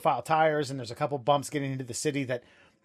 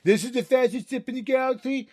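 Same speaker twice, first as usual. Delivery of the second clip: smug voice, pitched up high; Luke Skywalker; 7 semitones higher, 4 dB louder than usual. Acted. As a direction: do a smug, high-voiced young space pilot. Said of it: "that smug Luke voice".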